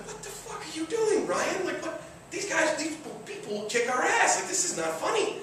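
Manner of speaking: high, panicked mock alarm; panicked friends. Friends in the car panicking at Ryan. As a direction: pitch it way up in retold panic. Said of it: "everyone in the car's like".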